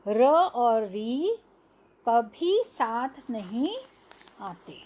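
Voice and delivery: र और री spelled out very slowly, fading at the end; slow emphatic rule. Shown as a demonstration. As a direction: stretch each word so the kids catch it. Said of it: "देख रहे हैं". (धीरे-धीरे समझाते हुए)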